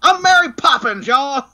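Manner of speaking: high, loud sing-song announcement; a hammed-up cheery nanny cry; mock Mary Poppins. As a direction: squeal it like a storybook nanny. Jokey Mary Poppins outburst.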